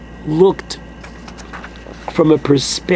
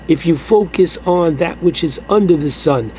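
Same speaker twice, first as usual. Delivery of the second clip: ordinary talk, nothing marked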